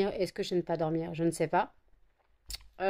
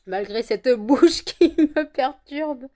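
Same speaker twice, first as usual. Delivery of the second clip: laughing; 6 semitones higher, 9 dB louder, slower than usual. Real, not acted. Laughing at her numb mouth.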